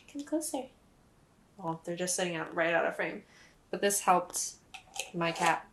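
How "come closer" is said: coaxing call. Real, not acted. Coaxing cat out of frame.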